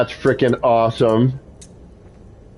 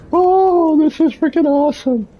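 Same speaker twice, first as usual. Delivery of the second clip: high squealing delight; excited squeal. Real, not acted. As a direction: squeal it out, thrilled. Overwhelmed by build.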